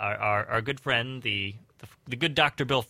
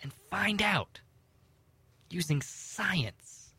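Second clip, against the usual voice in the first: spooky whisper; 8 semitones higher, 5 dB quieter than usual. Acted. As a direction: whisper it like a mystery. Spooky tease to listeners.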